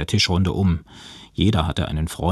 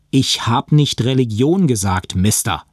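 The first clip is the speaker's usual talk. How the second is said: louder, higher indignant retort; emphatic correction; 5 semitones higher, 5 dB louder than usual. A narrator's reading. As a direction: put him straight firmly. Indignant young woman corrects him. (energische Zurechtweisung)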